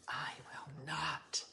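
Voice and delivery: hushed, breathy retelling of a flat refusal; quoting a friend in a whisper; whispered quote. Retold or imitated. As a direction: whisper her refusal back. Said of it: "She's like".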